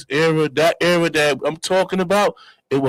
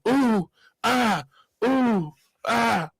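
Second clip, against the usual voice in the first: higher crooned oohs and ahs; 6 semitones higher, much slower than usual.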